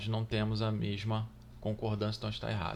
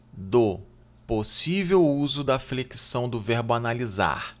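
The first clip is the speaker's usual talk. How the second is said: louder, emphatic read of the question; reading the exam item; 8 dB louder than usual. Acted. Teacher reading the exam question aloud to students, emphatically. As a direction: read the item out strongly to the class.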